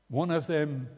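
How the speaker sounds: normal talking voice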